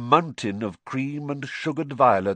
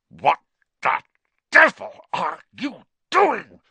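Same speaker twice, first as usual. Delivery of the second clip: through gritted teeth; 6 semitones higher, 6 dB louder than usual. A narrator's reading. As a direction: spit each word out in fury. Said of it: "said Uncle Vernon through gritted teeth".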